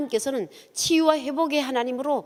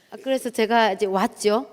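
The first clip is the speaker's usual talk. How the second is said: ordinary talk, nothing marked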